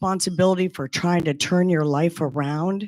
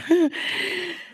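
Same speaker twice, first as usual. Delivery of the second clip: bright, high self-conscious laugh; a quick embarrassed giggle; short laugh; 13 semitones higher than usual. Real, not acted. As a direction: laugh at yourself lightly. Self-conscious laugh ending speech.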